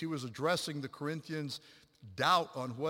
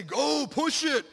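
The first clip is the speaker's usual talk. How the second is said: loud, high, stretched-out yell; urging shout; 11 semitones higher, 12 dB louder, much slower than usual. Retold or imitated. Dad urging runners up the hill, long loud shout. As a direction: push the runner on with a big shout.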